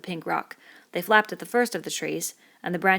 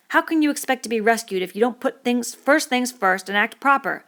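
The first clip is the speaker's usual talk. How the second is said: Piggy's indignant lecture, louder and higher; a fussy boy scolding the whole group, voice raised; Piggy's tirade; 6 semitones higher, 8 dB louder than usual. Acted. Piggy scolding the laughing boys, loud and indignant.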